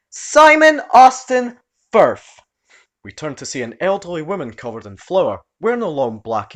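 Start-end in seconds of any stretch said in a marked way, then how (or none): 0.1-2.4 loud, high, slow full-name yell; old aunt scolds her nephew; furious yell. Acted. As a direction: bellow his full name like an angry aunt.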